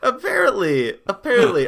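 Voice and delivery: laughing through the words, disbelieving; almost crying with laughter. Real, not acted.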